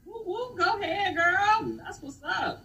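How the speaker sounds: high whooping cheer; excited cheer. Real, not acted. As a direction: hype her up with a whoop. Cheering a friend's success.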